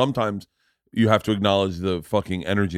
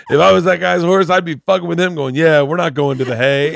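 laughing while quoting; 8 semitones higher, 8 dB louder than usual. Real, not acted.

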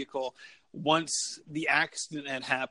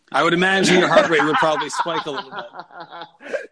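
laughing; 8 dB louder than usual. Real, not acted. Joke about sex and heart rate.

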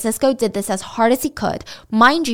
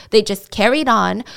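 ordinary talk, nothing marked

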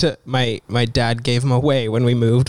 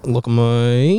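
bad Australian "look at me"; broad Aussie vowels, rising at the end; Australian accent; slower than usual. Shown as a demonstration. Showing co-hosts their Australian trigger phrase, bad Australian accent. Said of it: "I have a really bad Australian accent".

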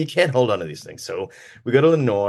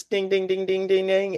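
game show bell; 7 semitones higher, 4 dB quieter than usual. Acted. Bell sound for right answer.

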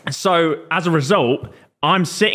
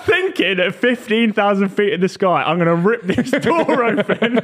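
higher-pitched, incredulous, breaking into laughter; laughing mock alarm; 6 semitones higher than usual. Teller mocks passenger's fear of the door being ripped open, laughing. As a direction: laugh through a mock-dramatic threat. Mocks his imagined mid-air door opening.

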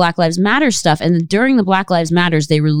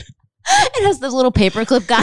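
ordinary delivery

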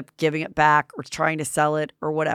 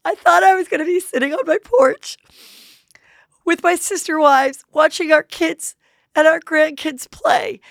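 whiny mock wife; 11 semitones higher, 5 dB louder than usual. Mocking Robin's teary complaint.

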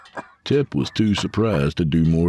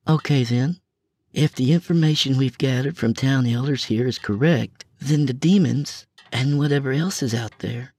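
woman explaining plan; 9 semitones higher than usual. A narrator's reading.

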